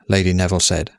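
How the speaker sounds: usual speaking voice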